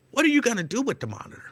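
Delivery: high, quick curious clerk; mock clerk voice. Imitating the store clerk asking about the monitor, high and quick.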